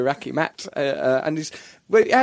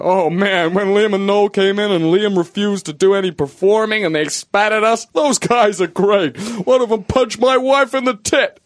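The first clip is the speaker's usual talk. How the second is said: mock gushing American exec; 3 semitones higher, 3 dB louder than usual. MTV executives gushing about Oasis, loud and awestruck. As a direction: rave like a starstruck executive. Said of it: "They go".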